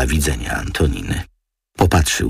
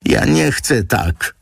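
higher, slow protesting cry; 10 semitones higher, slower than usual. A narrator's reading. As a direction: refuse it with a mild shout. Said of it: "krzyknęła gospodyni".

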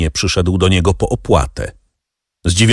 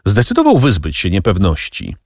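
ordinary delivery

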